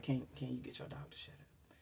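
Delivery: soft unvoiced whisper; hushed annoyed whisper; whispered complaint. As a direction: complain under your breath to the neighbor. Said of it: "That's just like".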